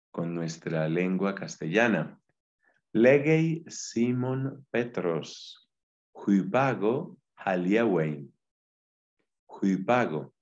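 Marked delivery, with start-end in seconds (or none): 2.9-8.3 Legei Simon Petros read slowly and carefully; slow Greek reading. Shown as a demonstration. Teacher reads the Greek slowly for students. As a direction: spell out huipago haliewein syllable by syllable. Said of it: "Observa que la Y".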